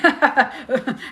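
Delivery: loud burst of laughter; laughing. Real, not acted. Speaker amused recalling a triggered guru, laughing loudly.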